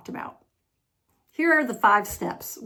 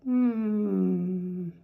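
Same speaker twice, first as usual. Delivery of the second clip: long, steady, easy "mm"; relaxed M hum; 3 semitones lower than usual. Shown as a demonstration. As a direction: hold "mm" with loose lips. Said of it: "Just relax your lips".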